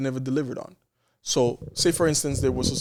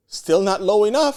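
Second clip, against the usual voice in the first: loud, high, slow mock protest; indignant comeback, retold with volume; defiant retort; 8 semitones higher, 7 dB louder, slower than usual. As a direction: push back loudly, drawing the words out. Retelling his defiant reply to bill.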